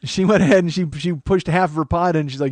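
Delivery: normal talking voice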